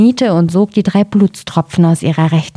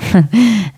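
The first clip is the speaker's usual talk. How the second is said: short real laugh at a mistake; a quick embarrassed chuckle; laughing at own slip; 3 semitones higher than usual. Real, not acted. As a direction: laugh breaking the reading. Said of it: "jetzt wird's schon langsam schwieriger".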